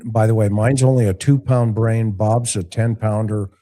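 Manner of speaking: ordinary talk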